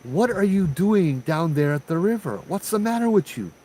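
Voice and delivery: high-pitched call down to the river. Retold or imitated. Calling down to girl at river.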